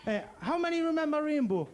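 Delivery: usual speaking voice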